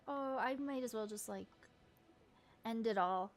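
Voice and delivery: ordinary talk, nothing marked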